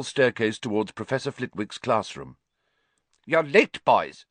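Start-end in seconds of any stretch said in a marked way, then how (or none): 3.2-4.3 high, louder scolding; a tiny teacher chiding; reproving. Acted. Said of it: "said Professor Flitwick reprovingly".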